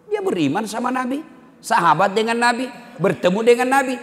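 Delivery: high, forceful sermon delivery; emphatic preaching. Acted. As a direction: list each point with rising insistence. Preaching at raised pitch. (khotbah tegas bernada tinggi)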